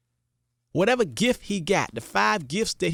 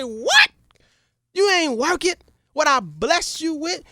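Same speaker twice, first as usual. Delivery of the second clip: angry king; 9 semitones higher than usual. Acted. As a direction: scold the servant in disbelief. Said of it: "The king was so mad".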